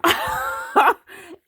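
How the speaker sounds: voiceless burst of high laughter; real unvoiced laugh; breathy laugh. Real, not acted. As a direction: let out an airless giggle. Breaks off laughing.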